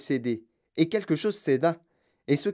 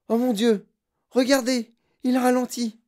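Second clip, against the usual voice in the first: alarmé; 8 semitones higher, 4 dB louder than usual. Acted. Témoin alarmé.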